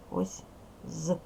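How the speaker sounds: usual speaking voice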